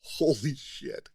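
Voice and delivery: drawn-out, stunned holy shit; stunned slow disbelief. Retold or imitated. Retelling meeting Peggy, slow stunned disbelief. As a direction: let it sink in slowly, amazed. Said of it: "And I'm like".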